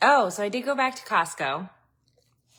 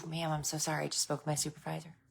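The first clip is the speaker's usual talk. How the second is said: soft quick apology; 10 dB quieter, faster than usual. Retold or imitated. Quoted rep, soft quick.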